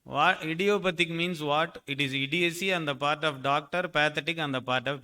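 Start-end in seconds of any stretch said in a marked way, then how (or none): none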